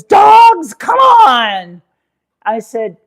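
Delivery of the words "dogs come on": high, loud call to the dogs. Retold or imitated. Husband calling all three dogs, loud and high. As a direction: sing out to round up the dogs. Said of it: "he calls all three dogs and he says".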